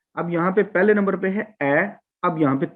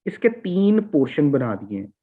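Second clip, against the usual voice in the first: ordinary talk, nothing marked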